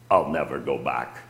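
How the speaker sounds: low, menacing imitation of a demon. Possessed brother-in-law refusing on the phone, low demon voice. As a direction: give the devil's answer down low and cold. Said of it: "I'll try and imitate that voice".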